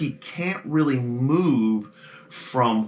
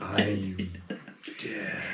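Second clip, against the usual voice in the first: soft, lower ghost voice, flat and lost; hollow ghost murmur; 5 semitones lower, 10 dB quieter than usual. Acted. Ghost Terrence repeating to the players that he is dead, soft murmur. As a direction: murmur it like an empty echo.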